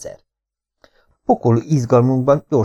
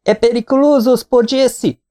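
shouting; 13 semitones higher than usual. Acted. Ali shouts at the supposedly deaf waiter. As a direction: yell it as if he were deaf. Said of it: "Ali kiabálni kezd".

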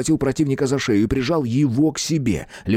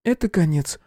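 detached; 6 semitones higher than usual. Acted. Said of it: "безучастным голосом добавила Клёпка".

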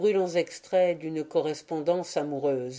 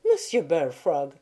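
gently. A narrator's reading. Wife soothing her husband, gently. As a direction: coax him kindly by name. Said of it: "reprit-elle avec douceur".

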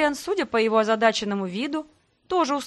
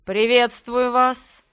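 rustling voice; 2 semitones higher, 6 dB louder, much slower than usual. A narrator's reading. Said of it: "прошелестел рядом голос".

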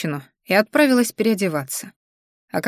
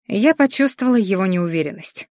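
ordinary delivery

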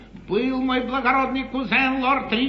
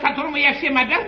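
normal talking voice

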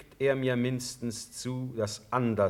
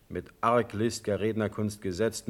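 usual speaking voice

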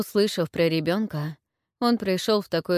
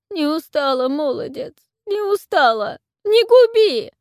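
put-on high, loud wailing old hag, much higher; lamenting plea; 10 semitones higher, 9 dB louder than usual. A narrator's reading. Witch laments to the warlord begging for mercy. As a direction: wail and beg for your life. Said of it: "вновь запричитала старуха".

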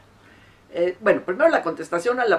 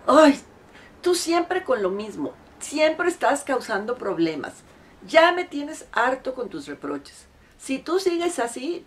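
higher, exasperated retort; annoyed partner; 7 semitones higher, 3 dB louder than usual. Retold or imitated. Husband fed up with his complaining wife, exasperated. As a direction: snap back, sick of the reproaches. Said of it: "así como con mucho fastidio".

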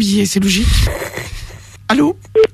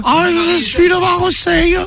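put-on Marseille accent; 4 semitones higher, slower than usual. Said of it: "faire la Marseillaise".

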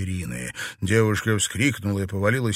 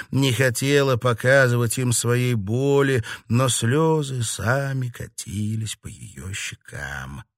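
ordinary delivery

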